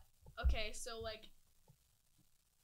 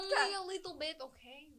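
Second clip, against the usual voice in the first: put-on loud, raised Russian-accented delivery; 5 semitones higher, 11 dB louder than usual. Joking about vodka, playful Russian accent. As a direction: keep up the hammy Russian. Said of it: "Can anyone do Russian?".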